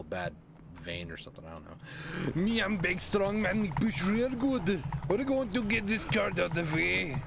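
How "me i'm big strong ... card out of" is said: mock caveman voice; big strong man voice. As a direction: puff up as a dim strongman. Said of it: "like, that accent, "Me and big strong man".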